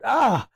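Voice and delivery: sharp high yelp of pain. Retold or imitated. Child hurting his foot, yelps out high. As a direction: yelp as you land wrong on your foot. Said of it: "he yelled out".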